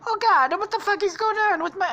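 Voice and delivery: very high, loud cartoon dismay; panicked character voice. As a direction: squeal in mock exasperation. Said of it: "He's like".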